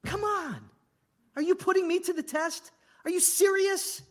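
high, loud, incredulous rebuke; God in sharp, high-pitched disbelief; exasperated God. Acted. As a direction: act out an exasperated God who can't believe it. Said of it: "God says".

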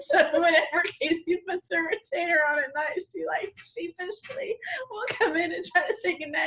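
high laughing tell; giggling through the story; laughing while talking. Real, not acted.

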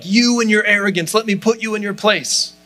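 hammed-up fiery putdown; fiery rebuke. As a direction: put him in his place with fire. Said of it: "powerful, fiery".

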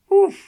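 high woof exclamation. Real, not acted. Reacting to news.

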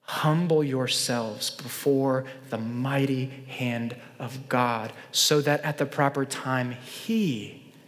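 slow, soft, low reading; solemn scripture reading. Acted. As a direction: read the verse reverently and slowly.

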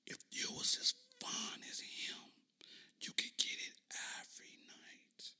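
unvoiced breathy whisper again.

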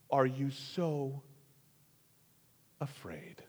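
slow, hushed questioning; gentle rebuke. Acted. Jesus asks his disciples, a slow hushed rebuke. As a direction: ask softly, near a whisper. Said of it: "turns to his disciples and says".

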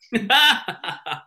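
high, bursting laugh at himself; embarrassed laugh. Real, not acted. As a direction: laugh it off, sheepish. Laughing at own old nickname.

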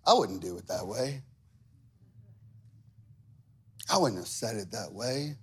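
a hint of a low, sniffy critic; judgmental critic. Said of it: "here's their classic line".

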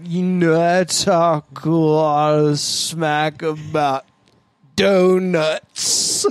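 very slow, stretched half-speed voice; half-speed playback. Retold or imitated. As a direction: drag every word like a slowed podcast. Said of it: "but it sounded like".

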